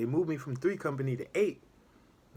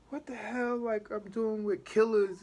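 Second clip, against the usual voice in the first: 1 shocked people; 9 semitones higher than usual. Retold or imitated. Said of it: "they're like".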